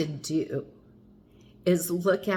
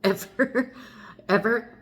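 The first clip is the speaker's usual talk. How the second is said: laughing emphasis; 5 semitones higher, 3 dB louder than usual. Real, not acted.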